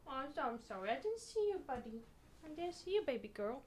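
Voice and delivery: high, soft apology to a pet. Real, not acted. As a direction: soothe the cat you just stepped on. Comforting the stepped-on cat.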